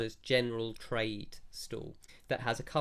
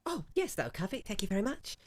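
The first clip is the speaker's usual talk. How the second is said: much higher, pleased trader voice; shopkeeper voice; 9 semitones higher, faster than usual. Acted. Branderi takes the silver from the player, high and pleased. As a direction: thank the buyer like a delighted merchant.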